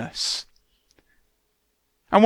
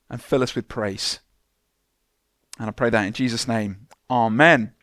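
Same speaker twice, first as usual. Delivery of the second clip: prayer; 5 semitones lower than usual. Acted. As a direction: pray humbly over the congregation. Let's bow our heads.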